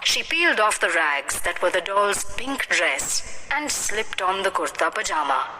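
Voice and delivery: plain reading voice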